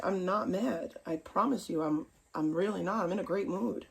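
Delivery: calm them down, half-joking; mock reassuring. Soothing reply to worried viewers.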